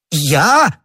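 high-pitched startled protest; shocked cry. A narrator's reading. Prisoner accused of deceiving the cardinal, shocked cry. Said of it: "вскричал Бонасье".

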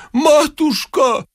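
high, drawn-out gushing endearment; tenderly moved. A narrator's reading. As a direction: coo it high and slow, overcome. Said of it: "умилился отец Фёдор". (умилённо, протяжно и тонко)

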